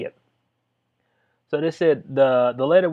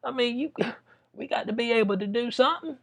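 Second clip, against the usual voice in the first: pleading police chief; 7 semitones higher than usual. Retold or imitated. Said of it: "So she's saying right now".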